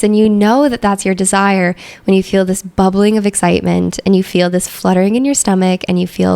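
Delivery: normal talking voice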